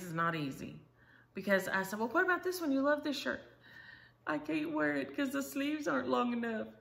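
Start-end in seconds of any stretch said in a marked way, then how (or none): 2.0-3.4 high sing-song coaxing; high coaxing grandma voice to a fussy child. Retold or imitated. 4.3-6.7 put-on high little-girl complaint; high picky-kindergartner voice about sleeves; fussy child. Voicing fussy granddaughter.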